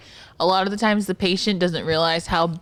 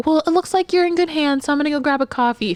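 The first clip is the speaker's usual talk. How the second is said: high, breezy excuse to leave; high-pitched cheery visitor; departing family member; 8 semitones higher than usual. Acted. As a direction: make a polite exit, pitched up.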